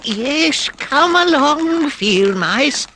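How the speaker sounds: very high, slow, eager call; eager Mole. A narrator's reading. Mole to the field mice, eager and high. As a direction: beckon them in delighted. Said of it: "cried the Mole eagerly".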